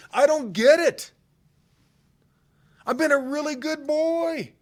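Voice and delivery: builds to a high, loud desperate cry; crying out to God. Acted. Voicing the psalmist crying to God.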